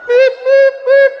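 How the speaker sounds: high mechanical beep imitation; beeping noise. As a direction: mimic a reversing forklift beep. Imitating transpallet reversing beep.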